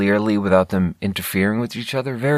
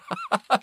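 high laugh; 5 dB quieter than usual. Real, not acted. Laughs at the pun.

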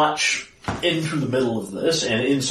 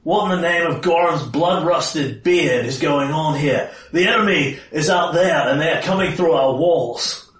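forceful in-character rebuke; stern, angry commander; 6 semitones higher, 4 dB louder than usual. Acted. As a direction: take command and demand an explanation. Player voices angry character confronting Basil.

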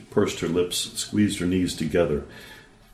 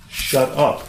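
a firm, raised shut up; louder; 7 semitones higher, 6 dB louder than usual. Acted. Girl tells her brother off in the session, louder. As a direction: say it up, louder and firmer. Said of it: "say that a little louder".

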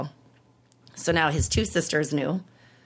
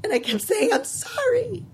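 voice breaking; 8 semitones higher than usual. Real, not acted. Telling father she has cancer.

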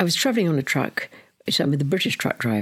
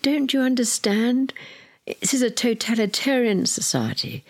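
Polish doctor; 8 semitones higher than usual. Retold or imitated. Polish doctor voiced in the story.

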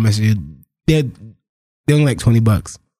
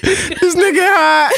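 high, drawn-out words inside laughter; cracking up; much slower than usual. Real, not acted. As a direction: squeeze it out slowly through a laugh. Still cracking up at post.

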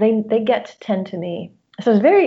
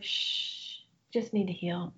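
hushed whisper, much softer; soothing inner whisper; 13 dB quieter, slower than usual. Retold or imitated. Wise inner voice hushing her worry, whispered. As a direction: shush yourself gently like calming a child. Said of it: "the little voice would be like".

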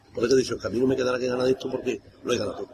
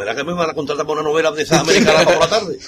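louder, laughing through the joke; 4 semitones higher, 10 dB louder than usual. Retold or imitated. Joking to the band about the soap-opera crying, laughing loudly. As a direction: crack up while telling it.

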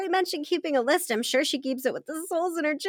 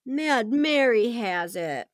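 dejected; much slower than usual. Acted. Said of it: "She slumps down".

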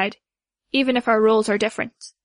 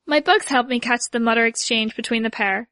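ordinary delivery, nothing marked

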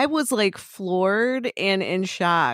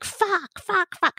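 much higher mock-grandma cursing; swearing grandma; 12 semitones higher, slower than usual. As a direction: imitate grandma swearing in a high voice. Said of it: "then I hear my grandma".

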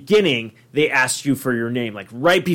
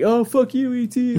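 high-pitched mock taunt; 10 semitones higher than usual. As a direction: squeal it in a high, playful voice. Mocking the ride while laughing along.

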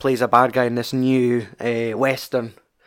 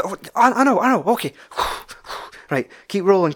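higher, flustered mumble, then brisk; flustered actor; 7 semitones higher than usual. Retold or imitated. Actor on set covering a flub, flustered then brisk. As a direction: brush off a flubbed line and push on.

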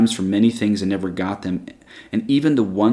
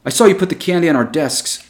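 put-on a boy blurting it out, raised and high; outburst; 7 semitones higher, 4 dB louder than usual. A narrator's reading. Johnny exposes the teacher in front of the class, blurting it out. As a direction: let it burst out as an accusation. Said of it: "Johnny burst out".

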